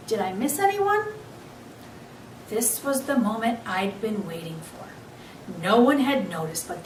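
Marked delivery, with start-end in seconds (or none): 0.1-1.0 high, bright question to the class; lightly voiced teacher; teacher asking. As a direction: sweep the circle with the question. Teacher asks the circle.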